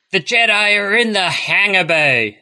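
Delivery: put-on a Californian surfer faking the Neimoidian accent; surfer dude Nute Gunray accent. Mocking the pilot to a co-host, surfer dude faking a Nute Gunray accent. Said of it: "some L A surfer dude just trying".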